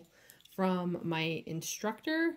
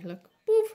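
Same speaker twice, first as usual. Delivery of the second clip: high, louder boop; playful boop sound; 9 dB louder than usual. Retold or imitated. Nail artist showing viewers the bag leak, playful high boop. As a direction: pop the boop out cheerfully. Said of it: "It went like".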